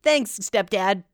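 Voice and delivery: put-on sarcastic boyish thanks; mock kid thanks. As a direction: play the grateful little kid.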